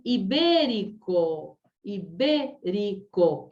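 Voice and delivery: "ibérico" slowly sounded out, stretching the BÉ; drawn-out syllables. Shown as a demonstration. Teacher showing students where the word is stressed, drawn-out syllables.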